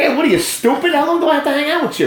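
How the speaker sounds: exasperated Brooklyn guy, laughing through it; Brooklyn accent. Acted. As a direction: rattle it off in high-pitched Brooklynese. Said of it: "like from Brooklyn".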